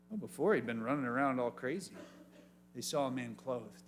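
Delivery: normal talking voice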